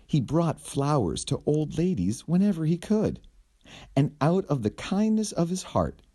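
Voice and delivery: usual speaking voice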